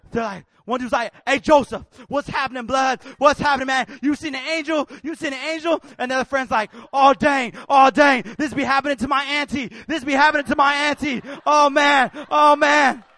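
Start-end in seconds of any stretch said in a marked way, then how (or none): 1.2-5.8 loud, startled friend's outburst; excited alarm. Retold or imitated. Friend questioning weeping Joseph about an angel, loud alarm. 6.9-13.0 very loud, freaked-out exclamations; panicked shouting. Retold or imitated. Friend watching Joseph bawl, shouting in alarm.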